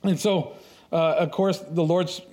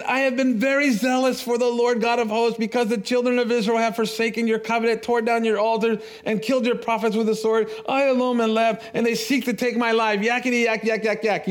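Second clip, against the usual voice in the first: high, droning recital of grievances; mock complaining; 7 semitones higher than usual. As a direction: rattle off the complaint in a high, mocking drone. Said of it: "now he's complaining to God again".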